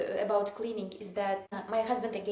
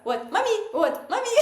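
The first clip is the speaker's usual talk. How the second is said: annoyed mom; 12 semitones higher, 7 dB louder, faster than usual. Retold or imitated. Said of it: "I was like".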